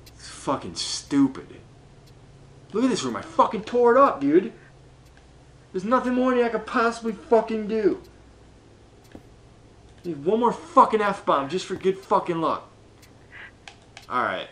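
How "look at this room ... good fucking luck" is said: higher, louder frustrated venting; exasperated rant. Real, not acted. Gamer who tore the room up, exasperated. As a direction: complain loudly with raised pitch.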